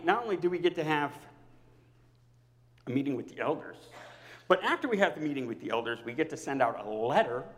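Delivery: ordinary delivery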